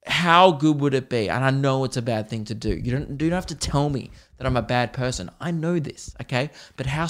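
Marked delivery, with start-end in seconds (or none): none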